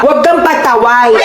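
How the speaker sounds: usual speaking voice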